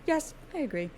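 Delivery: high silly voice. Shown as a demonstration. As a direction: play it as a high comic echo. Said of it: "repeated in silly voice".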